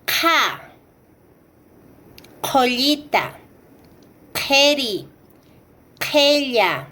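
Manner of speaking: "ka", "Joyita", "Keri", "Kella" begun with a rasped puff far back in the throat; "ka", "Joyita", "Keri", "Kella" with airy uvular qh; aspirated Q. Shown as a demonstration. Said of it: "Continuamos y tenemos".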